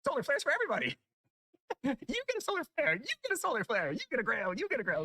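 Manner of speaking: high, hammed-up prize announcing; mock giveaway host. Player pretends to give everyone weapons, gleeful mock-host squeal. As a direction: hand out prizes in an excited high voice.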